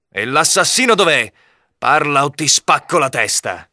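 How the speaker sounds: high-pitched, threatening interrogation; angry demand. A narrator's reading. Knight threatening Robin for the killer's whereabouts, angry demand.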